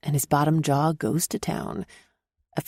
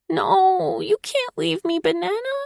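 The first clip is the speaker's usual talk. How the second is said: put-on pleading child voice; 14 semitones higher than usual. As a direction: beg like a clingy child. Child protests grandmother leaving.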